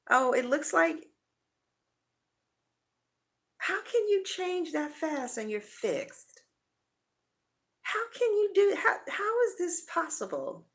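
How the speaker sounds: high, incredulous disbelief at the change; acting shocked partner. Partner reacting to Scorpio's change in high shocked disbelief. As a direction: play the partner who can't believe it. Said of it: "This is how your partner is gonna respond".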